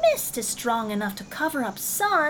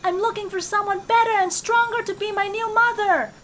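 high, loud kitten call up to the sky; kitten calling loudly; 11 semitones higher, 6 dB louder than usual. Acted. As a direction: shout up to the sun in a high kitten voice. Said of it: "called loudly".

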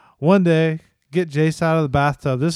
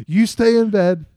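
firm dad orders; 5 semitones higher, 4 dB louder than usual. Retold or imitated. Dad ordering son and wife.

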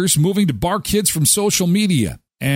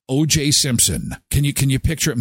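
ordinary delivery

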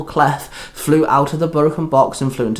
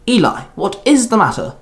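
higher-pitched, swooping question; concerned question; 7 semitones higher than usual. A narrator's reading. Queen asks Eli what is the matter, concerned. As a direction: ask it with a rising, urgent lift.

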